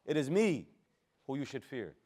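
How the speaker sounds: "me" stressed, the rest falling away; emphasis on myself. Shown as a demonstration. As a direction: hit "me", then drop off on the rest. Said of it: "I'm putting the emphasis on myself".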